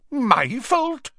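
very high, affronted question; stung disbelief, voice shooting up; indignant. A narrator's reading. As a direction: squeak it out, affronted. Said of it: "colouring up".

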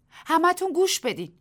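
loud, high call to everyone. Acted. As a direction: make the whole crowd listen. Tessie calls to the crowd.

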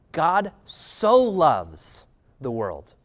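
very slow and deliberate; slow emphasis. Acted. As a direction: let each word land slowly. Repeats verse slowly.

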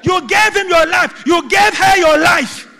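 slow, loud, high shouted preaching; booming slow pulpit shout; full-force preaching. Acted. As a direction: hammer each phrase at the congregation.

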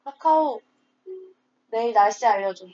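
calling out to a smart speaker; talking to voice assistant. Real, not acted. Asks Kakao speaker for weather.

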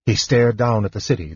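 ordinary delivery

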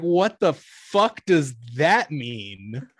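slow, loud disbelief; incredulous. Retold or imitated. Cashier baffled by the firefighter, slow loud disbelief. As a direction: drag it out in disbelief. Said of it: "And I was like".

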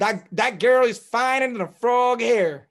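drawling Georgia accent put on, slow; Southern accent. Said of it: "I learned South Georgia shit".

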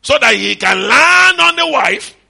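slow, high, loud shout; shouted climax. Real, not acted. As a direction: hammer the point home slowly. Preaching climax.

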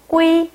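꾸이 high and level, tense k; model pronunciation. Shown as a demonstration. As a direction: sound out 꾸이 high for learners. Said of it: "읽어볼게요".

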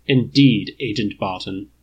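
dry, disapproving butler AI; judgmental. Acted. Jarvis rebukes Clint, dry and disapproving. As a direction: let the judgment drip, coolly. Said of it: "sounding very judgmental indeed".

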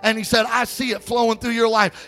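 high, fervent testimony from the pulpit; high-pitched, emphatic storytelling sermon; impassioned preaching. Real, not acted. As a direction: retell it with rising preacher's urgency.

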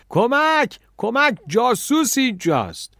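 very high, loud alarmed cries; screaming for help. A narrator's reading. Café owner raises the alarm, screaming. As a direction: yell for help in panic. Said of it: "کافه چی فریاد زد".

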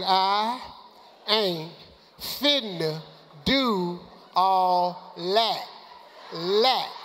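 I ... ain't ... finna ... do all that, each word spaced out; very slow, word by word. Shown as a demonstration. Asked by the coach to say it slowly, drawn out. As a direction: draw "finna" out slowly with long gaps. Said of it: "Could you say that slowly for me".

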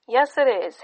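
proud father, full of joy; joyful. A narrator's reading. The father to his wife about the baby's first word, joyful. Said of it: "Hapac said joyfully".

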